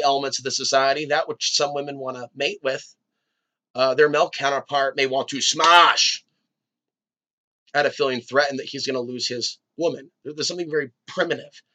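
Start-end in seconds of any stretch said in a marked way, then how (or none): 5.4-7.9 sudden loud burst on one word; mock aggressive. Host voicing the jealous male counterpart, mock aggressive.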